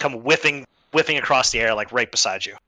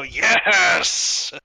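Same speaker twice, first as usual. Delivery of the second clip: drawn-out, raised cheer; triumphant yes; 5 semitones higher than usual. Acted. Yorn seeing an enemy go down, triumphant drawn-out yes. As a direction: gloat with one long yes. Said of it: "you hear Yorn go".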